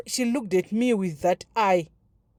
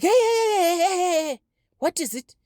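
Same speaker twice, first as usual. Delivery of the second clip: imitating a headmaster's high repeated heys; mock startled shout, very high; alarmed halting call; 9 semitones higher than usual. Headmaster halts the running girl.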